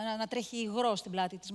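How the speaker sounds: ordinary talk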